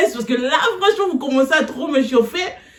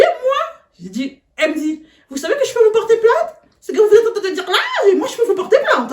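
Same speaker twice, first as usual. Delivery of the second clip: higher, half-laughing threat; mock indignant teacher; 8 semitones higher than usual. Teacher threatens to file a complaint, high and mocking. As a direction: threaten a complaint in a shrill put-on voice.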